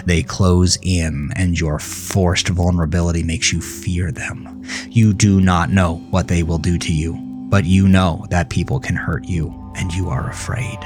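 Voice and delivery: plain reading voice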